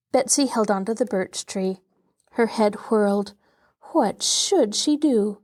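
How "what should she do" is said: usual speaking voice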